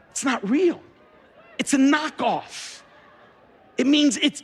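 high, emphatic spelling-out; exasperated explaining. Real, not acted. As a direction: break it to them as if they just won't get it. Mock-explains knockoffs to congregation.

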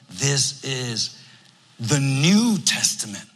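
slow, stress New as the peak word; emphasis on New Testament. Shown as a demonstration. Pastor quoting Jesus to the congregation, New stressed. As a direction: lean on "New" in "the New Testament", drawn out. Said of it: "And I'm putting emphasis".

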